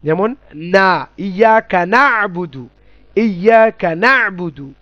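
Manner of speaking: ইয়াকা না'বুদু drawn out with a clear nasal ন; slow recitation demo. Shown as a demonstration. Teacher showing নুন in ইয়াকা না'বুদু, slow recitation. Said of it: "এখানে নুনের উচ্চারণ".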